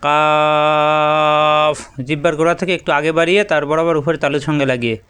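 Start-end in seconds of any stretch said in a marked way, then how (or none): 0.1-1.8 "কাফ" with its a drawn out very long and even; "কাফ" held long and steady like a tajweed teacher; long kaf. Shown as a demonstration. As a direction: stretch "কাফ" slowly, holding the a for four alif. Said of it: "চার আলিফ পরিমাণ টান হবে".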